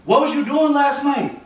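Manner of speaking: high, heated demand for answers; angry interrogation. Real, not acted. Boyfriend asking what she did last night, angry.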